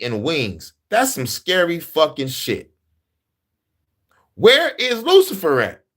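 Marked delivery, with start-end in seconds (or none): 4.3-5.8 incredulous question. Real, not acted. Mock outrage at missing villain.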